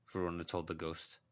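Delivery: usual speaking voice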